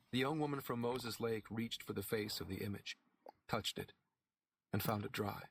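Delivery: plain reading voice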